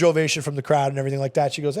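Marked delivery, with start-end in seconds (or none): none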